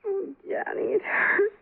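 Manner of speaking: woman with a twisted ankle tearfully begging for help; crying in pain. Acted. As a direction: whimper through the pain. Injured ankle, she whimpers.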